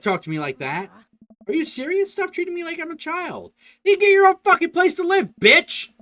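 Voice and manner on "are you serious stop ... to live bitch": put-on pure teenage rage; furious scream. Daughter screaming at her dad, furious.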